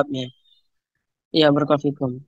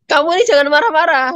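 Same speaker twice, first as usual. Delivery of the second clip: high, loud mock scolding of a child; scolding parent; 8 semitones higher, 3 dB louder than usual. Parent scolding a child while telling it not to be angry, high mock scold. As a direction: snap at the kid the way an angry parent would.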